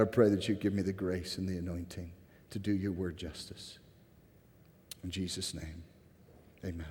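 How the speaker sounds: soft, low, reverent prayer; hushed pastoral prayer. Real, not acted. Leads the congregation in prayer.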